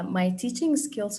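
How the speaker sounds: normal talking voice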